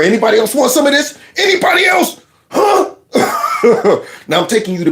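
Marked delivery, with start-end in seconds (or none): 0.0-2.9 playful challenge climbing higher; mock challenge. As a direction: call out for the next taker, pitch jumping up.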